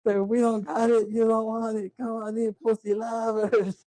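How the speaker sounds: high, slow hammed-up barker call; mock carnival barker. Mocking the club barker to his friend, high drawn-out call. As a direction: bark it like a sleazy club doorman. Imitating movie barker's pitch.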